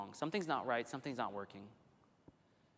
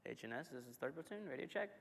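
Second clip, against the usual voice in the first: softer, unsure repeat; unanswered radio call; 8 dB quieter than usual. Retold or imitated. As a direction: call again, doubting, pitch rising. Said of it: "What you didn't want to happen was".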